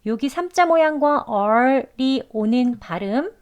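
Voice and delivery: ordinary talk, nothing marked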